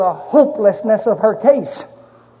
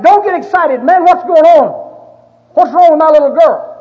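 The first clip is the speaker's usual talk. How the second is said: a father's raised, desperate demand, higher and louder; frantic shout; 6 semitones higher, 8 dB louder than usual. Retold or imitated. Father shouting at the doctor over his dying daughter, frantic and loud. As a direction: yell it at the doctor, frantic for your baby.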